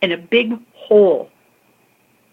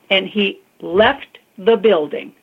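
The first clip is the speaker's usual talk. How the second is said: ordinary talk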